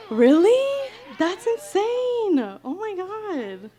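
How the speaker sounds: gasp, then high, slow disbelief; real shock at the crowd's answer; gasping amazement, pitch way up; stunned surprise. Real, not acted. Crowd names favorite song.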